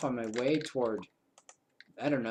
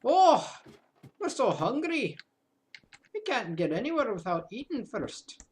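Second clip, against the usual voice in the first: high, loud whine of hunger; hammed-up hungry cry; mock hungry whine; 10 semitones higher, 5 dB louder than usual. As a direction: whine like a starving pet owner. Character needs food urgently.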